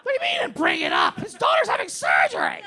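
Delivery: high, loud incredulous yelling; comic shouted outrage; outraged shouting. Retold or imitated. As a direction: demand answers in an exasperated high shout. Retells outraged questioning, acted.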